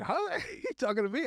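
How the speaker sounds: high, startled double take; mock bewilderment. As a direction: act out being caught off guard. Acts out his startled reaction.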